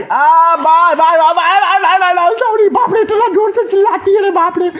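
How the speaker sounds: very high, loud frightened yelling; panicked screaming. Real, not acted. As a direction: shriek in fright, words tumbling over each other. Jump scare from granny in game. (डर के मारे चीखते हुए)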